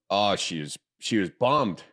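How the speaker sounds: normal talking voice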